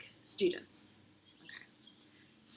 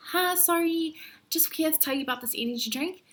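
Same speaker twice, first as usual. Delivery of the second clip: much higher, bright, pushy opener; hammed-up eager pitch; 9 semitones higher, 4 dB louder than usual. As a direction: chirp it out like a street promoter. Yet another attempt at a stranger.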